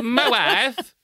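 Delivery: high, bouncy Borat-style "My wife"; Borat impression. Retelling the movie to a friend, in a high Borat accent. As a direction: do Borat saying "My wife" with a rising lilt. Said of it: "sound like Borat".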